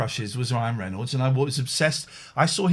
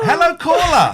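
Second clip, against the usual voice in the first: loud laughing greeting; 10 dB louder than usual. Real, not acted. Greeting caller on air.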